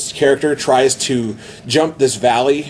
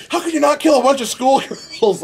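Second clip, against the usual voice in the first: pissed off; 11 semitones higher than usual. Retold or imitated. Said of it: "he's getting pissed".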